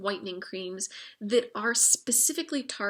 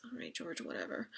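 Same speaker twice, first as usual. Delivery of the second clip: dismissive whisper; 2 semitones higher, 12 dB quieter than usual. Retold or imitated. Mocking Coco's dismissive reply.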